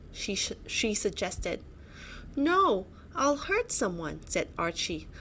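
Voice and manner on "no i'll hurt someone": high anxious refusal; worried protest. A narrator's reading. Archie afraid his club will hurt his friends, worried high protest. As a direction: refuse it, afraid of hurting anyone.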